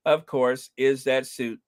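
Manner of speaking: ordinary delivery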